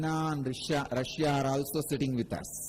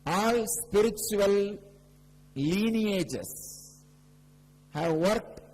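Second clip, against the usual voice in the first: higher, louder preaching voice, slowing on key phrases; fervent preaching; 6 semitones higher, 4 dB louder than usual. Acted. Guru preaching about the third eye to devotees, raised and fervent. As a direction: proclaim it to the whole hall.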